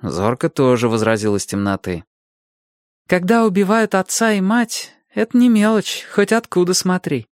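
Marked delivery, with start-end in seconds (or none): 3.1-7.3 put-on high, insistent child voice; objecting child. A narrator's reading. Boy objecting to the old man from darkness, high and insistent.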